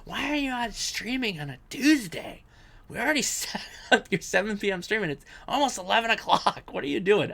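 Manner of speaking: hammed-up wife scolding, pitched high; imitating wife. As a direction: scold him like an annoyed wife. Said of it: "gonna get yelled at my wife later".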